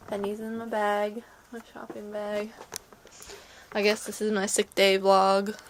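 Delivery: normal talking voice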